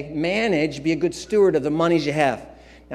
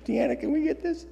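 pleading to wife; 10 semitones higher, 4 dB quieter than usual. Retold or imitated. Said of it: "I go".

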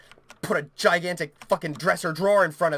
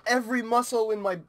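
ordinary delivery

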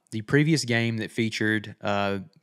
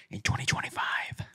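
whispering; 8 dB quieter than usual. Acted. Teasing the release year.

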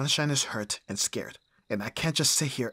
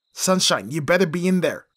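loud, higher call through the house; 4 semitones higher, 9 dB louder than usual. Acted. Bodyguard hunting for the missing client: loud call. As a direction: call out, demanding an answer.

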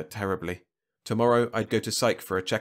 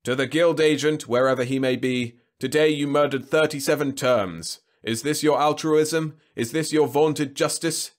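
booming PA announcement; 6 semitones higher, 6 dB louder than usual. A narrator's reading. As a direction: thunder it through a loudspeaker. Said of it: "the public address speaker boomed".

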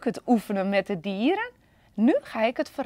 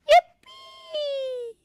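very high, squealing cheer; joyful whoop; 8 dB louder, much slower than usual. Acted. Panda flying through the air, shrieking with joy. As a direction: soar and squeal with glee.

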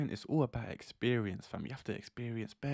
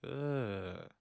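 disgusted ugh. Retold or imitated. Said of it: "she's like".

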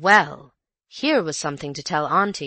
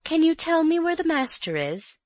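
respectful maid; 11 semitones higher than usual. A narrator's reading. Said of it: "in a low, respectful voice".

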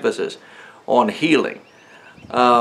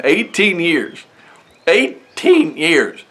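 slow, higher, leaning on "eighteen"; emphatic repetition; 4 semitones higher, much slower than usual. Real, not acted. Preacher stressing her long suffering to the congregation, slowly. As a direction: stretch out "eighteen years" slowly to make it sink in.

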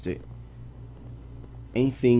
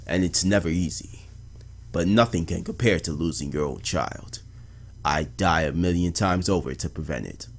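low, measured journal narration; subdued, lower detective voice-over; 3 semitones lower than usual. Acted. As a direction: read it like a detective's somber case journal. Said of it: "detective narration, narration voice".

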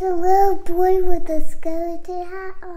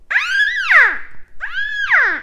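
short high squeals, imitating a scare prop; high mock scream; 5 dB louder than usual. Kid reliving a scary store prop, mock scream. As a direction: shriek like the Halloween animatronic.

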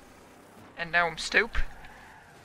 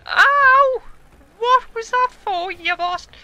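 pained yell; 14 semitones higher, 8 dB louder than usual. Real, not acted. Hit in game, yelling at player.

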